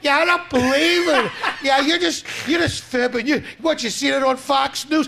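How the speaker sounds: high, loud mocking voice of a stubborn doubter; loud mock denial; mock disbeliever. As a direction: sneer it like someone refusing facts.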